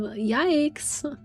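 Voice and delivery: normal talking voice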